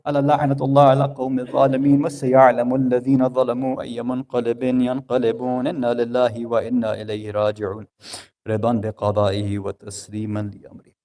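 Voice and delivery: دعاء سريع بصوت منخفض; rapid recited supplication. Acted. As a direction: rattle off the closing prayer formula quickly. Rapid closing Arabic supplication.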